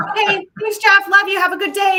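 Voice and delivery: high, bright mock-polite sign-off; fake cheery phone voice. Salesperson mimicking her own chirpy phone goodbye. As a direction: sing it sweetly like hanging up with a prospect. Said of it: "It's like".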